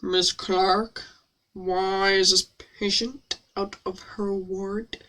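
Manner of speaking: low, soft, very slow impression; Alan Rickman. Shown as a demonstration. Doctor confronting Miss Clark, Alan Rickman impression. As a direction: draw it out quietly and deep. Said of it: "I do an Alan Rickman impression".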